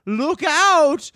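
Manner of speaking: high, loud warning cry; eagle calling out. A narrator's reading. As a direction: shout the warning from the sky. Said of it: "it says".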